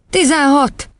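put-on high child's voice; hasty schoolboy answer. Boy answering the headmistress thoughtlessly, high blurt. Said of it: "vágta rá meggondolatlanul Róbert".

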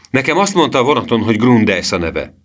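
mildly heated accusation; angry protest. A narrator's reading. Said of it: "kiáltotta Emil dühösen".

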